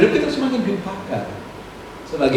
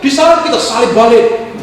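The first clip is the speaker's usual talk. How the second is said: higher and louder, a hammed-up temper flaring; mock fury at being wronged; mock angry outburst; 8 semitones higher, 8 dB louder than usual. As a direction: play the hothead who strikes back. Mocking hot-tempered believers.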